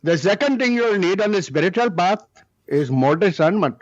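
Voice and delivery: put-on Indian-accented guru voice. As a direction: imitate the Indian teacher's accent. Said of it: "That's why I do the accent".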